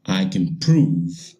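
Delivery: slow and weighty, every word pressed; slow emphatic repeat. Real, not acted. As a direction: spell it out slowly, for emphasis. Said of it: "I'll say it again".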